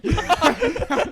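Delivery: loud, high burst of laughter; real laugh. Real, not acted. Telling friends about four bottles, loud real laugh. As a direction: crack up at the punchline. Teller laughing at own story.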